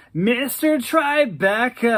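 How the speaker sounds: Daryl's pleased warble. Acted. Daryl delighted by his new nickname, slow high warble. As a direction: roll the nickname out, flattered. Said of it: "He laughed and said".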